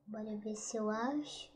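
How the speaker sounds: normal talking voice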